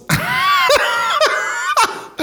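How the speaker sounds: real high-pitched cackle. Real, not acted. Comic tickled by a long muscle name, high laugh. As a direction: crack up at your own material.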